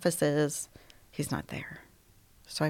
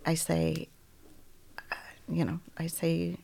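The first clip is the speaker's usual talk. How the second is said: ordinary talk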